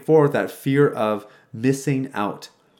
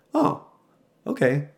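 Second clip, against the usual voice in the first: surprised; 2 semitones higher, slower than usual. Retold or imitated. Friend's surprised reply.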